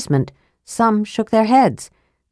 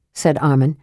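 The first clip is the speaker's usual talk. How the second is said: usual speaking voice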